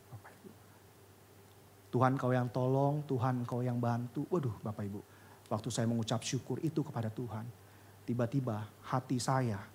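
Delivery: soft, low, reverent voice; hushed prayerful telling. Acted. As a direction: speak softly as if kneeling in prayer. Quiet prayerful testimony. (bersaksi dengan suara lirih penuh hormat)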